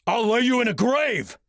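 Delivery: snapped threat. Acted. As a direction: fire the threat straight back. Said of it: "Ryan snapped back".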